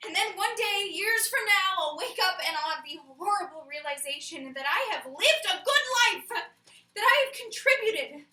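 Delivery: loud, theatrical horror; appalled dread. Acted. Imagining the horrible realization of a good life, appalled. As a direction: build to a high, outraged peak.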